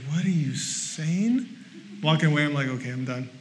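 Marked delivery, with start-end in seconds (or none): 0.1-1.5 slow, soft, puzzled question; mimicked bafflement. Preacher imitating baffled Kat's reply, puzzled and hushed. 2.7-3.3 low, very soft mock defeat; deflated giving up. Preacher mimicking himself walking away from Kat, quietly deflated.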